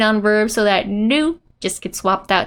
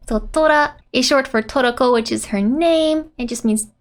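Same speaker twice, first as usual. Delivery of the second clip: ordinary talk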